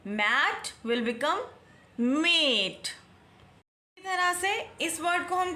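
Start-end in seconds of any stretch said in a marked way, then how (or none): 0.1-1.4 very slow "Mat will become"; slow and clear. Shown as a demonstration. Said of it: "ए का नाम बुलवाएगा". 2.0-2.9 mate with the letter-name a; mate with a long ay vowel. Shown as a demonstration. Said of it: "ए का नाम बुलवाएगा".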